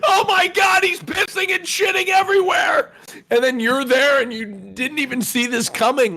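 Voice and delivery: high, loud panicked crying. Pretend. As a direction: shriek in mock panic. Panicked retelling with cries.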